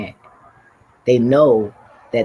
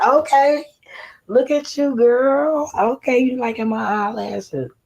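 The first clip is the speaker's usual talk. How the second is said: high flattered reply; 12 semitones higher than usual. Retold or imitated. Replying to the old lady's eyelash compliment, high and flattered. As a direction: play it up sweet and high. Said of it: "I was like".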